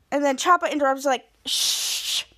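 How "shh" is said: long hissed "shh"; acted hush; shushing. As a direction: shush someone who interrupts. Character shushes another.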